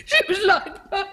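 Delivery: put-on a sob-laugh over the lost girl; crying. Cook grieving the dead maid.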